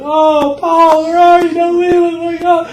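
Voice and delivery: high, loud hammed-up wail; mock wailing. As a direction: overdo the grief, begging Pa. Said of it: "they're going".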